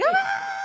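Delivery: very high, loud mock scream; horrified scream. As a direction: shriek as if horrified, half in fun. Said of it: "She screams in horror".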